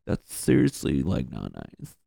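a hurt guy complaining, lightly hammed; whiny hurt dude. Buddy whining after being ball-busted, mock hurt. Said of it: "and they're just like".

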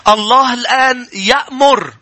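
high, slow solemn verse; slow emphatic reading; slow scripture reading. Shown as a demonstration. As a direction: announce the command slowly. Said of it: "أعمال سبعتاش ثلاثين".

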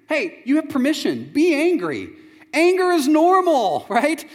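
high, loud, slowed proclamation; emphatic permission. Shown as a demonstration. Preacher urging listeners that anger is normal, exuberant. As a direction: preach it at full force. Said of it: "he's commanding you".

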